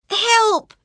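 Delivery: high, loud desperate cry; cry for help. Acted. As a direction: call out for help with everything. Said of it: "she cried".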